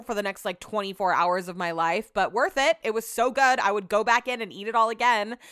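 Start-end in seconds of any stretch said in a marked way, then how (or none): none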